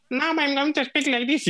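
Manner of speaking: forced high voice, mocking an unnatural style; unnatural put-on voice. Shown as a demonstration. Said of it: "if you have to speak like".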